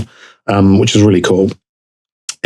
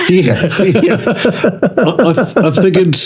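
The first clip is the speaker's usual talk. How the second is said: high, giggling through the words; laughing; 10 semitones higher, slower than usual. Real, not acted. Guest laughing at the host's origin-story joke. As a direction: laugh as you start to answer.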